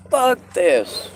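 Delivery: high loud exasperated outburst; frustrated shout. Real, not acted. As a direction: blurt it out loud, fed up. Second take bursts out loud.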